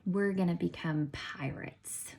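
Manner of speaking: hushed, secretive whisper. Acted. Teacher whispering the week's pirate theme to students. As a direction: let them in on a secret. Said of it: "teacher whispering a fun secret".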